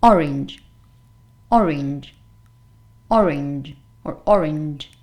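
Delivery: orange, OR hit hard, weak ending; OR-inj, low pitch with a strong opening; stress on first syllable. Shown as a demonstration. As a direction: punch the start of orange, reduce the second vowel. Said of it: "the stress is going to be at the beginning".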